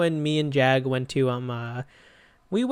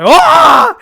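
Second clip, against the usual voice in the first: a huge high scream of fright, much louder; startled scream; 20 dB louder than usual. Retold or imitated. Gamer startled by a jump scare while streaming screams loud and high. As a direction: shriek like the jump scare just hit you. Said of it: "they jump scare with a scary face".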